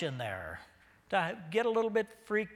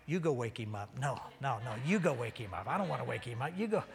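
low, hushed squabble; reluctant bickering; 5 semitones lower, 4 dB quieter, faster than usual. Acted. Disciples arguing over who wakes Jesus, hushed bickering. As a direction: pass the job off in a low, quick voice.

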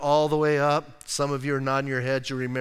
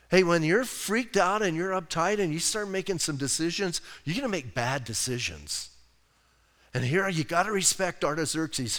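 ordinary talk, nothing marked

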